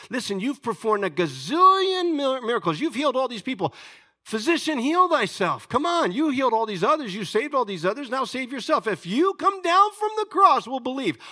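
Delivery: high, loud taunting demand; jeering challenge. Acted.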